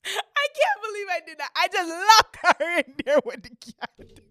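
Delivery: high, loud, cracking up; loud, incredulous confession; amused disbelief. Real, not acted. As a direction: wail it in gleeful guilt. Amused disbelief at own act.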